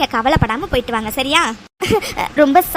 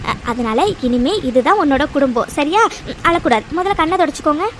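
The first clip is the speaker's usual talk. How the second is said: ordinary talk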